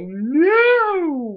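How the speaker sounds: long, high, loud wail of protest; mock dismayed cry. Reacts to friends being hypnotized.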